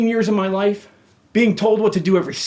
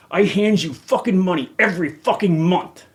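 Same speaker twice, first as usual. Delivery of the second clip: ordinary talk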